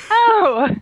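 high, drawn-out sarcastic sweetness; mock delight. Traveler to the rude border agent, sarcastic delight. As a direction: gush slowly and falsely, high-pitched.

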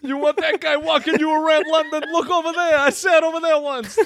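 high, silly New York tour guide; mock New York Dickens. Friend plays Dickens guiding a London tour, mock New York accent. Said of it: "That's how Charles Dickens talks?".

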